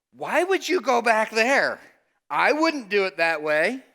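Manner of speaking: high, loud, slow incredulous challenge; doubting disciples. Retold or imitated. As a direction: push back on him in disbelief. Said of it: "The disciples look at him and say".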